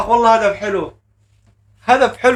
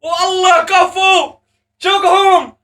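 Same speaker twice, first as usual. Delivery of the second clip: goal celebration; 8 semitones higher, slower than usual. Real, not acted. Fan celebrating Diaz's goal, high ecstatic shouting. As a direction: yell the scorer's name in triumph.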